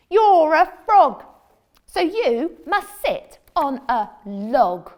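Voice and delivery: slow, loud, flat refusal; bossy cat. Acted. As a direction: drag it out, not budging. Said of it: "said the cat".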